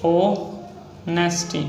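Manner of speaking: Honesty said very slowly while writing; slow dictation. Shown as a demonstration. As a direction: stretch honesty out as you write it. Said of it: "next है honesty".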